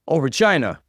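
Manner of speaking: a mild tearful plea; crying out. A narrator's reading. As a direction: wail her name as if heartbroken. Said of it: "she cried".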